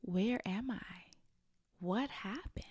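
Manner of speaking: hushed, slow, lost whisper; tearful whisper. Retold or imitated. Retelling her own bewildered tears in a whisper. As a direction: breathe it out like a bewildered child, barely voiced. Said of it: "sad and crying because I'm like".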